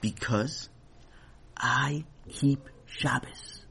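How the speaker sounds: slow, soft and solemn; a quiet, earnest stand on principle; very serious tone. A narrator's reading. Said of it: "with a very serious tone in his voice".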